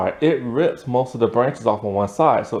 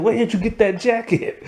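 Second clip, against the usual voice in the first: higher, laughing disbelief as Cam; half-laughing, pitched-up "where'd you get that"; imitating Cam, incredulous; 9 semitones higher than usual. Recapping Cam spotting Lily's jacket, high and laughing. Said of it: "He's all like".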